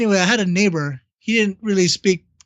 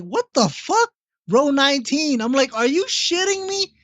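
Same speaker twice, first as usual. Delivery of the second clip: stunned disbelief; 8 semitones higher than usual. Retold or imitated. Telling a friend about surprise concert tickets, stunned high disbelief. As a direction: pitch jumps way up, retold shock.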